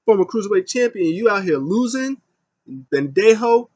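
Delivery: high, loud mock rant; hammed-up angry tirade listing his titles; Kalisto yelling. Kalisto yelling at Lindsay Dorado after losing, high loud mock rant. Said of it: "he's yelling at Lindsay Dema".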